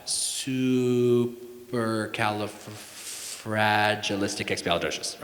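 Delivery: very slow, syllable by syllable; sounding it out. Shown as a demonstration. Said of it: "Sound it out".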